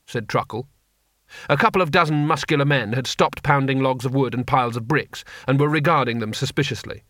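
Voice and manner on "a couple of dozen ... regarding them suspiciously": ordinary delivery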